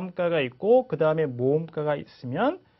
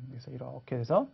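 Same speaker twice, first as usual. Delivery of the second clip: ordinary talk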